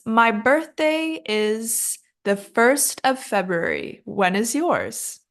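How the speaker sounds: slow, carefully spaced model sentence; practice sentence. Shown as a demonstration. Teacher popping the word into a sentence, slow demonstration. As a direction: enunciate slowly for learners to copy. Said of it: "Let's pop that into a sentence".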